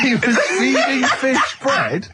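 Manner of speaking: high and slow, laughing all through; real laughing echo of the story; laughing disbelief. Real, not acted. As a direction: repeat it in giggling disbelief.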